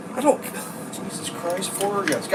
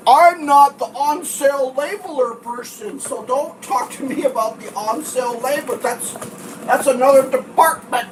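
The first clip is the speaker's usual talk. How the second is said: dismissive worker; 6 semitones higher, slower than usual. Retold or imitated. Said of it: "I assume what's going on there is".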